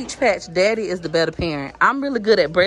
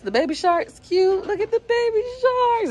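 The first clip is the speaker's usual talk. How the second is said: excited coo. Real, not acted. Excited over baby shark shoes.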